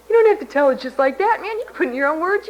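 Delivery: lightly voiced friendly reassurance; quoting Julie. Friend reassuring her about telling the story, lightly voiced. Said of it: "Julie from Wichita said".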